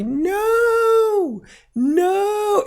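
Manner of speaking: put-on high firm toddler no; child's firm no. Parent mimics her little boy refusing, high and firm. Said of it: "it's literally".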